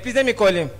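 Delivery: ordinary talk, nothing marked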